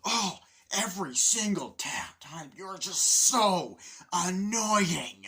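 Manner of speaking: loud, high, drawn-out complaint; exasperated rant. Retold or imitated. Fed-up man rants at annoying roommate, loud exasperation. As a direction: stretch out the last words in loud exasperation.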